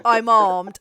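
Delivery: loud imitation of the warning; mock threat. Game master replays the villager's threat, loud mock warning. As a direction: blurt it out loudly, near your own pitch. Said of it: "And going like".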